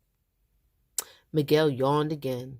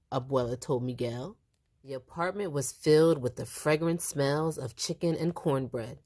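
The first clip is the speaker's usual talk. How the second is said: ordinary delivery, nothing marked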